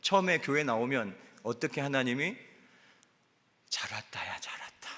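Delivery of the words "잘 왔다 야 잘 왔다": hushed, breathy welcome as God; tender whisper. Acted. God whispering welcome to a newcomer at church. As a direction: whisper it like a loving father.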